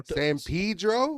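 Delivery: "Pedro" mispronounced as PEE-dro, drawn out and high; mocked anglicized pronunciation. Comic mocking how locals say street names, "Pedro" as a drawn-out PEE-dro. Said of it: "you guys pronounce it like it's a vegan bakery".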